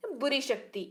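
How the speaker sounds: ordinary delivery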